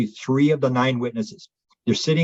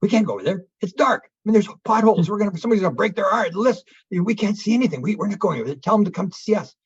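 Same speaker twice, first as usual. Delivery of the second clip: higher, nervous pleading, a touch frantic; frightened campers refusing to walk into the dark, higher and anxious; panicky protest; 7 semitones higher than usual. Retold or imitated. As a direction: protest like you're scared of the dark field. Frightened group refusing to cross.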